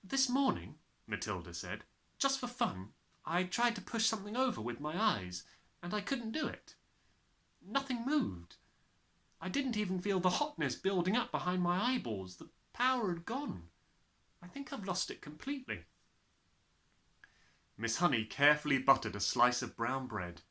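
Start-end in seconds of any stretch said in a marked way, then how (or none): 0.0-0.8 put-on higher, childlike voice; little girl telling her teacher something strange; young girl Matilda. 2.2-15.9 put-on higher, childlike voice; little girl telling her teacher something strange; young girl Matilda.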